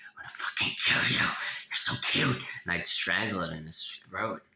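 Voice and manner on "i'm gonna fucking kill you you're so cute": intense threatening whisper. Retold or imitated. Reliving childhood urge toward a puppy, strained fierce whisper. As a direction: squeeze it out fiercely, as if overcome by cuteness. Re-enacting threats to a cute animal.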